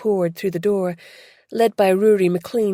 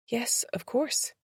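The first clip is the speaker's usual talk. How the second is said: much softer, slow, faint agreement; faint reply; 5 semitones higher, 8 dB quieter, slower than usual. Acted. Beth asked to go to the station, faint reply. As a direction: let it come out barely there. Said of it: "she said faintly".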